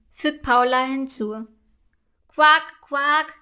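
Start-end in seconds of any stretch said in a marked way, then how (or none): 2.3-3.3 two short croaks, first louder; frog croak. A narrator's reading. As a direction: imitate a frog's quack. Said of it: "macht es erneut". (quakender Frosch)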